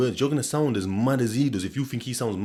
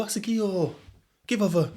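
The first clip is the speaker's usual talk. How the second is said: "give over" in broad Sheffield, raised high; Sheffield accent; 9 semitones higher than usual. Shown as a demonstration. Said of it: "a Sheffield boy".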